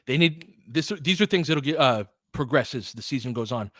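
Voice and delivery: ordinary talk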